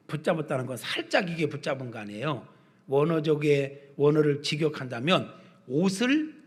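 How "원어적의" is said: normal talking voice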